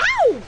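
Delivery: high, loud ow of pain; pained yelp. Acted. Bride pricked during dress fitting, pained yelp. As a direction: yelp as if jabbed by a pin.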